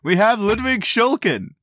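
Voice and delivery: high excited guest introduction; grand entrance announcement. Acted. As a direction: hype up the guest's arrival.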